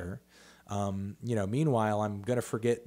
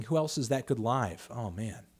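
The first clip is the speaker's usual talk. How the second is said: usual speaking voice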